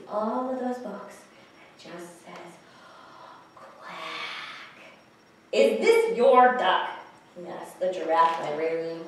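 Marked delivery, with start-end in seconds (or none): 3.5-4.8 soft, drawn-out duck quack; awed duck quack. Acted. Duck sees all those books, soft awed quack. 5.5-6.9 loud, slow, word-by-word question; emphatic question. Acted. Alligator asks the giraffe librarian, loud emphatic question.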